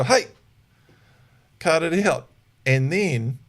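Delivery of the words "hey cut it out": firm correction. Shown as a demonstration. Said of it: "stamp your foot".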